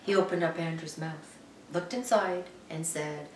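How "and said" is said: usual speaking voice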